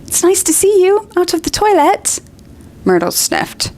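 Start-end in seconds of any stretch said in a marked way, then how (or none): 0.1-2.2 high, forced cheerful Hermione; falsely bright. Acted. Hermione to Myrtle, forced cheer. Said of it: "falsely bright voice".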